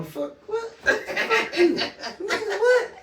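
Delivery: high, cracking voice between laughs and tears; laughing through tears. Real, not acted. Overcome with grief, laughing through tears.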